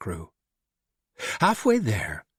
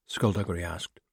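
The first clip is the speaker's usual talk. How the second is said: ordinary delivery, nothing marked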